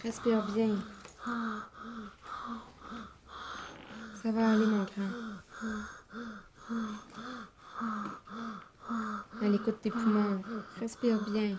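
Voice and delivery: put-on gently calm a scared child; soothing a child. Mother calming her sick son. (voix douce pour rassurer un enfant)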